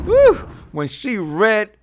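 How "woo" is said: high, loud "woo"; excited whoop. Real, not acted. Host reacting to a savage viewer comment, excited whoop. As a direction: let out a delighted whoop.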